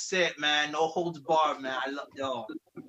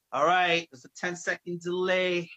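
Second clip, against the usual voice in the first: ordinary delivery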